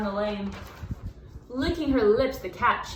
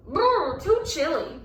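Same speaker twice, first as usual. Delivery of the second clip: squeal; 5 semitones higher, slower than usual. A narrator's reading. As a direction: squeal it out, shivering.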